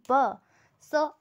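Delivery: ordinary talk, nothing marked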